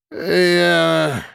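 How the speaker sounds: put-on a drunk man mumbling out a protest; drunken bellow. A narrator's reading. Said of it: "Пьяно заревел рыбец".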